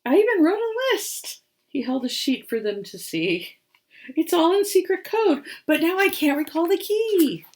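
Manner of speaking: high little rabbit voice, sorrowful; the rabbit confessing with a sniffle and a sigh; sniffly rabbit. Acted. Rabbit continues.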